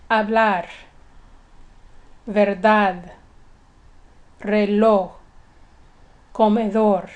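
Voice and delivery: Spanish "hablar, verdad, reloj, comedor", each stressed on its last syllable; "hablar, verdad, reloj, comedor" as separate Spanish examples with end stress; final-syllable stress. Shown as a demonstration. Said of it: "the stress is on the last syllable".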